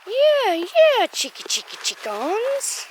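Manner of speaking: high teasing singsong, slowing; pet talk. Real, not acted. Teasing the silkies, high singsong. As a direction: tease the chickens fondly.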